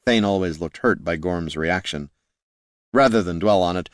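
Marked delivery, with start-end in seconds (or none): none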